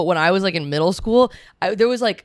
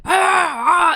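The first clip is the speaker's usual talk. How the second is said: mock Hitler shouting; 10 semitones higher than usual. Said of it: "Hitler's very, like ... very intense".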